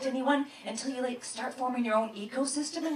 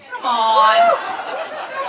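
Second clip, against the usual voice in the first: come on; 13 semitones higher, 9 dB louder, slower than usual. Real, not acted. Exasperated appeal to audience.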